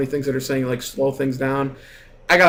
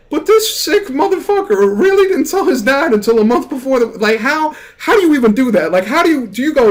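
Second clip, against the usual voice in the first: higher and louder, can't believe it; incredulous rant; 9 semitones higher, 4 dB louder than usual. Real, not acted. Friend vents to viewers about the wedding, incredulous and loud. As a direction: let the disbelief climb into a rant.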